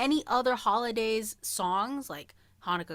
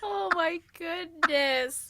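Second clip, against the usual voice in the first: mock despair; 7 semitones higher, slower than usual. Real, not acted.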